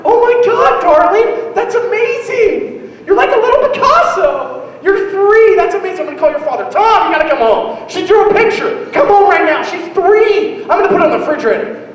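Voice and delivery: high, loud, over-the-top gushing mother; gushing mom. Retold or imitated. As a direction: rave over the toddler's drawing, pitch way up.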